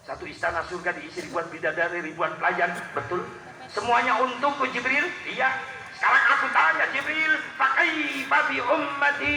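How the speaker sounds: rising plea. Acted. The Prophet pleading with Jibril for his people, swelling to a loud cry.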